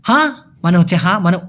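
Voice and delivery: normal talking voice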